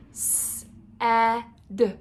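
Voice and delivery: "S, eh, d" broken into phonemes; sounding out. Shown as a demonstration. Teacher shows children sounds to make while writing, sounding out "S, eh, d". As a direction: say "S, eh, d" as three separate letter sounds. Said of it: "while you make the sounds".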